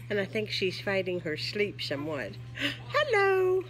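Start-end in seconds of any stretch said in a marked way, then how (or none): none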